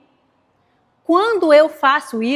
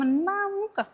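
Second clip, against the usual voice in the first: softly, pitched up, in mock disbelief; hushed disbelief; 6 semitones higher, 8 dB quieter than usual. Reacting to someone's mess at work, hushed disbelief.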